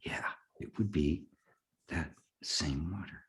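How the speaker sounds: hushed, nearly whispered awe; stunned. Retold or imitated. He answers the voice in the river, stunned and hushed. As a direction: let the words come out softly, with long gaps. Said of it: "I was just stunned".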